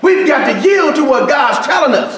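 rising shout from the pulpit; preaching at full force. Acted. Pastor preaching to the congregation, shouting.

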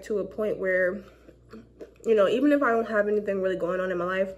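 speaking through fingers; muffled behind hand; hand over mouth. Real, not acted. Seen 0: Fingers cover lips until about 3.3s, then hand drops.

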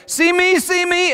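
put-on high, loud little-kid plea; child calling out. Small girl calling to a woman from the slide, high eager shout. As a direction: beg loudly to be watched.

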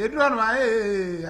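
ordinary delivery

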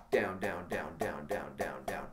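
soft, nearly whispered "down, down"; quiet counting. Shown as a demonstration. Teacher softly counts the taps while playing. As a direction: murmur the count under the drums. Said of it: "that first left hand tap".

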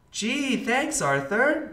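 put-on high, bright-eyed kid voice; lightly voiced little sister; little girl voice. A narrator's reading. DW's high child voice.

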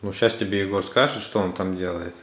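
ordinary talk

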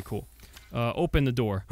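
ordinary delivery

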